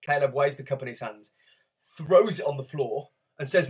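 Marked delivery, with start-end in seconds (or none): none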